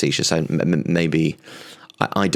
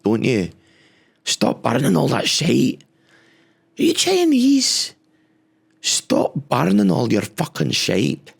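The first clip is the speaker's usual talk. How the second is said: higher, lightly put-on Glasgow voice haranguing world leaders; mock Glaswegian rant; 6 semitones higher than usual. Podcaster lecturing summit nations, hammed-up higher Glasgow voice. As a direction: play a cheeky Glaswegian telling nations off.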